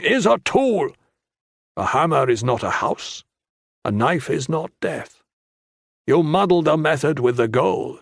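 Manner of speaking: sharp, high cut-in, then firm; stern monk voice. A narrator's reading. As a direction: interrupt and lecture sternly. Tan cuts in sternly.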